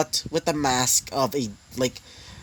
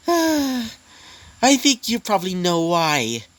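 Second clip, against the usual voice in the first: taunting demon lord; 7 semitones higher, slower than usual. Acted. Laughing demon taunts Ezekiel.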